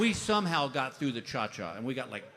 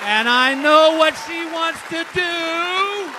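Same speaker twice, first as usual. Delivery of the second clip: very high, slow, held-out holler; wailing shout; 17 dB louder, much slower than usual. Acted. Injured husband realizing his wife's intentions, wailing shout. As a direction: wail it out at full volume, stretching the words. Comic panic at wife's bedtime hint.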